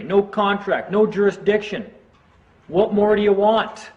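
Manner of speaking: high-pitched retold yell; yelling at the judge. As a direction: shout it up at the judge. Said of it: "And I'm just yelling at him".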